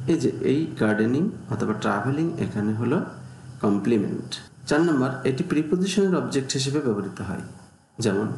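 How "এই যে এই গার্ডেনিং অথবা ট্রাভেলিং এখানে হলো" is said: usual speaking voice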